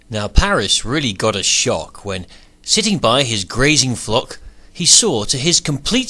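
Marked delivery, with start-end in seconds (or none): none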